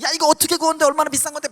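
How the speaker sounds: high, loud, fast scolding; angry friend. Retold or imitated. Roommates cursing him for spilling the powder, high fast scolding. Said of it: "막 이렇게 욕을 하더니".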